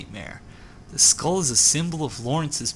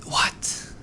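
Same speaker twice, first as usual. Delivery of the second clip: whispered what. Real, not acted. Surprised reaction to a find.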